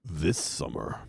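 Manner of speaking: low growling trailer voice, soft; lurid grindhouse trailer growl. Acted. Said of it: "a movie phone or, like, a trailer".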